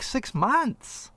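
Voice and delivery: ordinary talk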